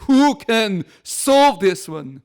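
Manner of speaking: booming drawn-out declaration from the pulpit; proclaimed answer. Acted. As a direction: ring it out word by word like a proclamation. God's answer, proclaimed loudly.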